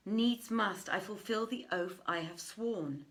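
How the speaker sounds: usual speaking voice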